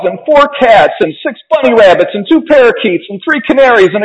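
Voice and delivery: high-pitched bratty whining list; Veruca shouting. A narrator's reading. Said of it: "shouted Veruca".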